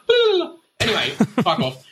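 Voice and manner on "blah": loud high blah, spaced out; a zoned-out groan of blah; dazed blah. Retold or imitated. Feeling the medication, a loud high spaced-out blah. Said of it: "so I'm like".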